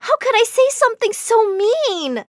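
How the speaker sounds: high, dismayed self-reproach; horrified regret. A narrator's reading. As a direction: berate yourself in dismay. Narrator horrified at own words.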